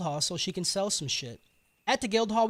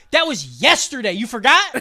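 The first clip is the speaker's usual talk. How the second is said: loud, incredulous reminder; mock indignant scolding; 3 semitones higher, 10 dB louder than usual. Goblin Slayer reminding the girl about the cave, loud incredulity. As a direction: scold as if they forgot the obvious.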